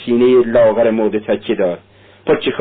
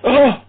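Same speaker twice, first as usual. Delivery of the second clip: high, sudden shuddering gasp; a jolt of fright escaping as one short high cry; sharp high gasp of a dying woman; startled cry; slower than usual. A narrator's reading. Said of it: "ناگاه لرزید و گفت".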